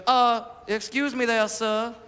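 put-on polite Savannah Southern belle accent; prim Southern belle. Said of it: "She's a prim and proper Southern belle from Savannah".

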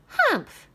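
high, loud scornful humph; witch humph. Acted. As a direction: huff like a witch. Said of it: "said the witch".

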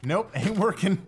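loud. Real, not acted. Frustrated gameplay.

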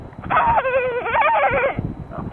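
dolphin sound. Retold or imitated. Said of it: "pretty good dolphin sound".

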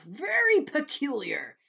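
high, loud, slow centipede voice; boastful centipede. Acted. Centipede answering James, loud and drawn-out.

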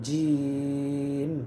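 জিম with the vowel held slowly; stretched four alif long. Shown as a demonstration. Teacher showing viewers the four-alif stretch, drawn out. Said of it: "জিমের মধ্যে চার আলিফ লম্বা".

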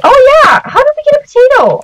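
high-pitched amazed outburst; excited surprise. Real, not acted. Player finds the pair have a potato, excited surprise. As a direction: exclaim high in real disbelief.

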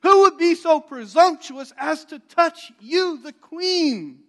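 high, loud outraged demand; a furious king, high and loud. Retold or imitated. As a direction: roar your outrage at the offender. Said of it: "The king is furious".

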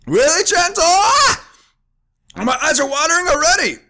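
high, strained voice from the wasabi burn. Real, not acted. Vlogger reacting to a spoonful of wasabi, high strained voice. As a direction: squeeze it out as the spice hits. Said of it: "I sound like Master Roshi".

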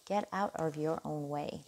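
a quick, self-mocking stab at an American accent; hammy American accent on the repeat, not quite landing; terrible American accent. Tarot reader repeats her advice to Scorpio in a bad American accent. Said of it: "That was a terrible American accent".

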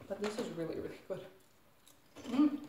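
chewing while talking; talking between bites of chips; mouth full. Real, not acted. Seen 0: Bites chip at 1.2, chews visibly until 2.6, then stops.

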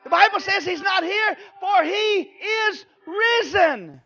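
ordinary talk, nothing marked

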